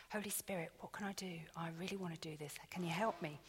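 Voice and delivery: hushed, urgent prayer under the breath; wife whispering a quick plea to the Holy Spirit; whispered prayer. Retold or imitated.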